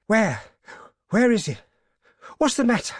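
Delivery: high, loud startled questions; alarmed. A narrator's reading. Old man woken by his wife's cry, in alarm. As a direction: fire off panicked questions, pitched high. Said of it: "He started up in alarm".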